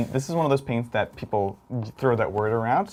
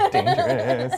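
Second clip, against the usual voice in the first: laughing mock warning; much slower than usual. Mock warning about mouse.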